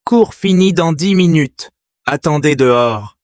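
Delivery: a teacher dismissing an intruder, high and loud; teacher's order. Acted. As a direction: order him out in front of the class.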